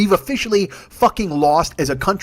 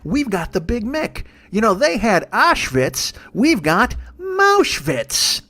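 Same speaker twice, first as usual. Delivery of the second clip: slow, higher mock politician voice; Jeff Sessions; 5 semitones higher, much slower than usual. Sessions boasting of a knock-off name, drawn-out mocking impression. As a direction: stretch out the silly names with mock pride.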